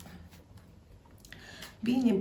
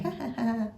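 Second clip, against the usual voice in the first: higher-pitched mock chuckle running into laughter; amused, high chuckling laugh; spirit's chuckle; 6 semitones higher than usual. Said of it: "just chuckling and chuckling".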